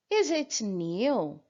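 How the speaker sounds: slow, careful Is it new; slow English demo. Shown as a demonstration. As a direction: model the question slowly for learners. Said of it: "Ele é novo?".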